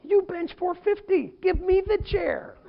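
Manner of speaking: high, slow mock protest; mock incredulous protest. Pastor to a strongman lifting a chair, mock protest. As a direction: drag it out high in comic disbelief. Pleading with a screaming attacker.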